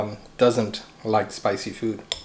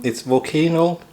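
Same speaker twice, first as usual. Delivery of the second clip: ordinary delivery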